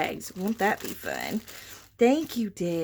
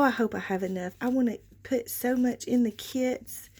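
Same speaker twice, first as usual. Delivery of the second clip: usual speaking voice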